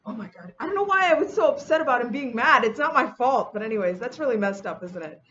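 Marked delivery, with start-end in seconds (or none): 0.1-0.5 breathless whispered oh my God; hushed whisper. Retold or imitated. Whispering in fear as her husband peeks in. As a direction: whisper it in dread, barely voiced.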